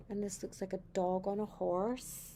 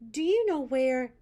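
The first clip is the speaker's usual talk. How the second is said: louder, higher call; 4 semitones higher, 11 dB louder than usual. Acted. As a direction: call after the officer. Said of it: "he asked a police officer".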